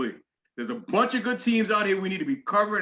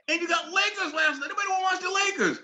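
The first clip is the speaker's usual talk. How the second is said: fast, higher shout of disbelief; exasperated shout; 9 semitones higher than usual. Real, not acted. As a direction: yell it at the network, fed up. Ranting at network schedule.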